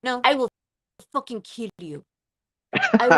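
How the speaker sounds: put-on forceful Russian-accented threat; aggressive Russian accent. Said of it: "was fucking aggressive".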